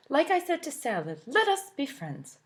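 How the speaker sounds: Carrot's voice. Acted. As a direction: Carrot offering friendship. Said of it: "said Carrot".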